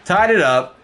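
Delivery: excited. Real, not acted. Tying the game up.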